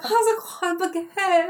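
put-on high-pitched frantic French accent; panicked mock French. Actress fleeing imagined vampire at audition, frantic mock French accent. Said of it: "try speaking a bunch of French".

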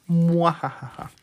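slow evil villain laugh; mustache twirling villain. Pretend. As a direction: gloat like a cartoon villain. Gloating over beating everyone. (risa malvada de villano)

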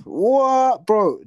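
high, loud disbelieving what bro; shocked what. Retold or imitated. Retelling the Atlanta guy, shocked disbelieving shout. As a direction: blurt it out in total disbelief.

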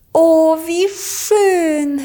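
sehr hoch und langsam gesungenes Oh, wie schön; entzückt und gedehnt als Amselfrau. A narrator's reading. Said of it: "hörte man da schon jemanden hinter der Tür sagen". (Amselfrau hinter der Tür, hoch, langsam und entzückt)

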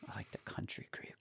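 breathy savoring whisper; hushed, dreamy whisper about gravy. Acted. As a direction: whisper it like a delicious secret. Savoring creamy gravy talk.

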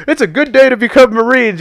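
high, loud, rousing impression; rallying chaplain. Chaplain to struggling recruits amid the mayhem, mock rousing. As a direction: imitate an over-keen chaplain cheering on recruits. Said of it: "The chaplain's like".

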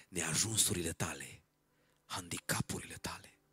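low, soft, measured sermon close; quiet, solemn assurance; hushed preaching. Real, not acted. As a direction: speak it gently with long pauses, nearly whispering at times.

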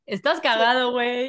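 playful imitation of Mexican Spanish; Mexican accent. Shown as a demonstration. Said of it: "dilo con el acento mexicano". (imitación juguetona del acento mexicano)

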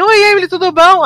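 high, sing-out mock hello; chirpy retold greeting; perky greeting. Voicing Jolene's cheerful greeting.

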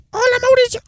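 high put-on cartoon voice; Simpsons catchphrase. Friend quoting a Simpsons bit, high cartoon voice. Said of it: "Es como el".

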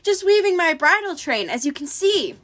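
high put-on falsetto. A narrator's reading. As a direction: answer in a feigned falsetto. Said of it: "said in his falsetto voice".

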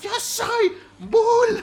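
very high, very slow laughing villain; hammed-up wailing villain boast; mock villain. Mock villain duel reply.

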